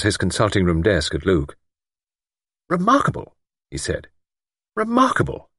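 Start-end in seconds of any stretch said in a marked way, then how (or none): 2.7-3.4 surprised. Acted. Doctor marvels at Luke's claim. 4.7-5.5 higher, lightly voiced doctor repeating the word; a hint of a fussy old doctor.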